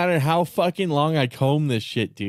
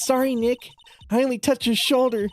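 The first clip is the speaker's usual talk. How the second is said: put-on a girl's voice, pitched up; higher-pitched Maya voice; 7 semitones higher than usual. Streamer reading the game girl's lines pitched up. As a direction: read Maya a notch higher and bright. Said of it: "the same voice, but higher pitched".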